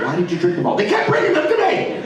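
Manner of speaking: high-pitched mock protest; hammed-up wail of an excuse; frantic exchange. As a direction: blurt it out in comic desperation. Acts out frantic exchange over drinks.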